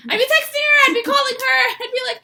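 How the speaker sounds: high, loud, tearful and breaking; sobbing the words out; crying. Real, not acted. Crying as she recalls frantically calling Mom.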